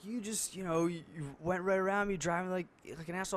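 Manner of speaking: high-pitched imitation of a griping driver; imitated old guy. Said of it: "he's this old guy".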